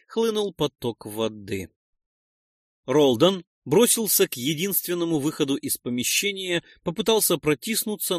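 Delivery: ordinary delivery, nothing marked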